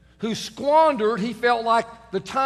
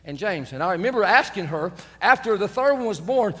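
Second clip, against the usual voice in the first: normal talking voice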